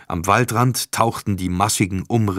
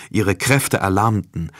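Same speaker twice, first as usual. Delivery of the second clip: plain reading voice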